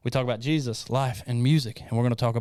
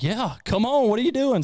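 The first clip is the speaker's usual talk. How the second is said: high-pitched retold invitation; eager urging; 11 semitones higher than usual. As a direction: urge a friend to come, pitch soaring. Retold eager reply to Grace.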